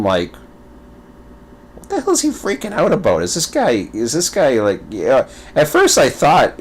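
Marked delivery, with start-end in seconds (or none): 1.7-4.8 high, quick baffled reaction; retold high-pitched bafflement; mock incredulity. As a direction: act out disbelief at someone's overexcitement. Retold puzzled reaction to streamer.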